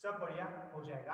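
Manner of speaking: quiet reassuring teacher voice; soft calm guidance. Real, not acted. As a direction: ease the class back down gently. Calming after shouting.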